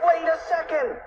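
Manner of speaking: high-pitched, urgent call; urgent cry. A narrator's reading. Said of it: "cried Fluffy".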